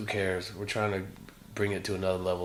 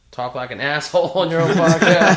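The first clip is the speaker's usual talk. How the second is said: loud, laughing, higher; laughing loudly; 8 semitones higher, 15 dB louder than usual. Real, not acted. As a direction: laugh through the words. Laughing at friend's grunt.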